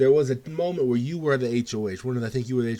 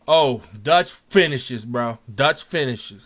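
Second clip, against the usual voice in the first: excited; 9 dB louder, slower than usual. Real, not acted. Hyping up Dutch's win.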